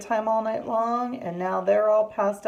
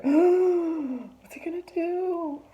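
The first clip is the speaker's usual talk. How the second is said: high, slow, scared gasp and question; gasp, then ask it high in mock dread; nervous gasp; 11 semitones higher, 3 dB louder, much slower than usual. Frozen friends fear the woken bear, nervous high gasp. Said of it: "they're frozen. They're like".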